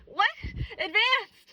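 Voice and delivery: high, loud incredulous squeak; shocked disbelief. Retold or imitated. Student told she's in advanced class, high shocked squeak. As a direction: replay the jolt of disbelief.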